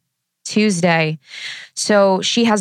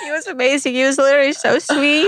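teary gush; 8 semitones higher than usual. Retold or imitated. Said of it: "I'm like".

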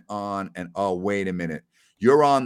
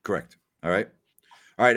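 normal talking voice

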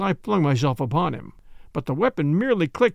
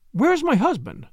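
higher-pitched, alarmed demand; frightened cry; 8 semitones higher than usual. Acted. Woman bursting in, looking for her husband, frightened cry. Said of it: "she cried, with a quick, frightened glance".

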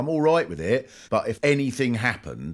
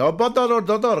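mock shouted Hindi; 7 semitones higher, 6 dB louder, faster than usual. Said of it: "started shouting Hindi across the field".

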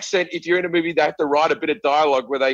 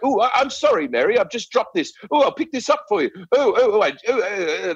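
higher, bumbling British romantic lead; Hugh Grant; 4 semitones higher than usual. Retold or imitated. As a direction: stammer and fluster through polite apologies. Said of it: "they Hugh Grant it around".

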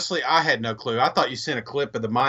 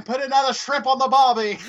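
mock Australian accent; 10 semitones higher than usual. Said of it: "an Australian accent".

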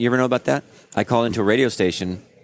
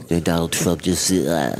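rough, half-hearted politician impression; trailing-off secondhand impression; Donald Trump; 3 semitones lower than usual. Shown as a demonstration. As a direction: badly fake the famous politician. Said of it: "you're really doing somebody else doing".

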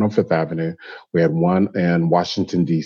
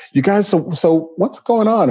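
lightly voiced life guide, higher and gentle; life guide asking; 12 semitones higher than usual. Life guide Zan asking the couple what's going on, higher voice. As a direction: ask them sweetly what's wrong. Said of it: "And she said".

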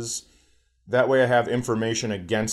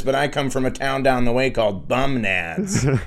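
pouty voice; 3 semitones higher, 4 dB louder than usual. Retold or imitated. Voicing the sperm character.

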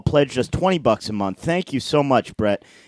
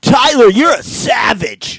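slow, loud, much higher bro shout; frat bro voice; 9 semitones higher, 7 dB louder, slower than usual. Acted. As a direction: hype up your buddy like a frat bro.

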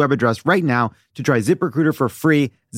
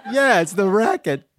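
laughing; 5 semitones higher than usual. Real, not acted. Laughing repeat of quote.

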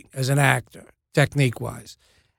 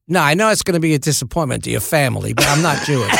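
brisk mock-Cockney; imitated Cockney, quick then pointed; Michael Caine; faster than usual. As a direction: let them down in a rough London star impression. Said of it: "This is a terrible Michael Caine impression".

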